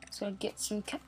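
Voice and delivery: ordinary talk, nothing marked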